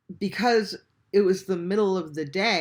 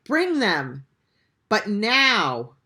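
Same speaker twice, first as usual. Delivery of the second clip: urgent order; 4 semitones higher, 8 dB louder, much slower than usual. Retold or imitated. Ordering friend to hurry up.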